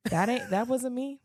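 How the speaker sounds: high-pitched mock protest of innocence; hammed-up denial, pitched well up; childhood denial. Speaker acts out denying wet pants to parents, high mock protest. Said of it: "And I would just be like".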